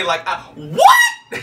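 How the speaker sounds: high, loud, very slow what; blurting out in disbelief; stunned. Real, not acted. Shocked at the Spice Girls news, slow and loud. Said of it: "it was like Tourette's".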